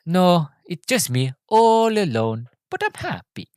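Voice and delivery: slow, high innocent Italian voice; Enrico. Retold or imitated. Lonely passenger answering the driver, slow innocent voice. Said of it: "he's like".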